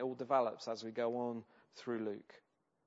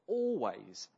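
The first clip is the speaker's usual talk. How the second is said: Always said long and firm; stress on Always; 3 dB louder than usual. Shown as a demonstration. Preacher stresses "Always" to the congregation. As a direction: lean hard on "Always". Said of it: "I can't emphasize that word enough".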